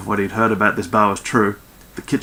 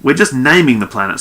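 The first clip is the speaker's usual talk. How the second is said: louder, higher objection; protesting; 4 semitones higher, 6 dB louder than usual. A narrator's reading. Skell refusing crew claims on a planet, protesting. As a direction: push back with mild exasperation. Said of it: "Skell protested".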